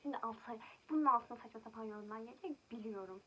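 loud, fed-up outburst; angry. Real, not acted. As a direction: blast the predicted comments loudly. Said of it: "çok sinirlenince böyle oluyor".

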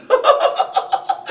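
high tittering stage laugh; mocking laugh. Gossip laughing at old coquettes turned prudes, mocking titter. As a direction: titter in quick high bursts.

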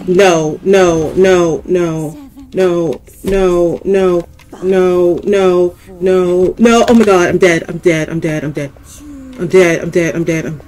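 loud frantic chanting of no; real panic, rapid and loud; terrified loud babbling; panicked. Real, not acted. Monster catches player.

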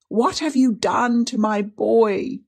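high, drawn-out anguished cry; rising shriek. A narrator's reading. Aunt accusing her niece, voice rising to shriek. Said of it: "her voice rose into a shriek".

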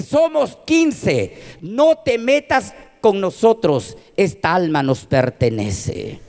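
slow, emphatic demon warning that drops at the end; intimidating demon. Retold or imitated. Demon claims the drunk man's soul, slow intimidation. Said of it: "Me quería intimidar por el número".

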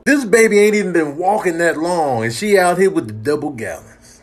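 ordinary delivery

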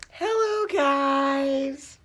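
squeaky high hello; sing-song high hello; high silly greeting. Acted. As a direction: chirp it like a cartoon kid. New character greets class.

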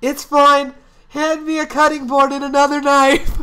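high, loud put-on voice; fully commit to the goofy voice; character voice. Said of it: "I'm committing to this voice".

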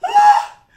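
high loud shriek of laughter; laughing scream. Real, not acted. Host cracks up at the caller's lingo, shrieking.

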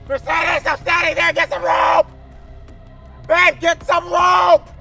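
loud, high frantic shouting; panicked yelling. Real, not acted. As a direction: yell for help in a panic. Boat sinking, yelling for rope.

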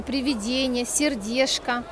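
ordinary delivery

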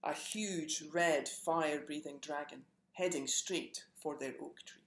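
plain reading voice

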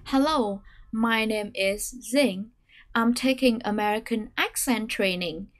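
slow read stepping down, with "accent" stressed; staircase intonation. Shown as a demonstration. Teacher reads a long model sentence slowly. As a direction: stress "accent", step down on the rest. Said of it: "chúng ta sẽ cùng quan sát".

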